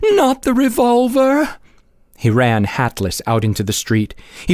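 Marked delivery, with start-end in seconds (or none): 0.1-1.6 much higher, frightened begging. Acted. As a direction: plead with him in panic. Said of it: "she begged".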